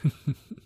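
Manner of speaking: a quick, amused laugh; a brief laugh at himself; short laugh. Acted. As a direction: laugh it off lightly. Reacts to being called out.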